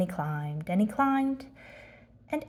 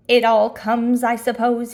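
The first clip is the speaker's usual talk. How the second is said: louder, rueful admission mid-fall; loud resigned call; loud musing; 2 semitones higher, 8 dB louder than usual. Acted. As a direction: shout it out wearily. Pooh on last branch.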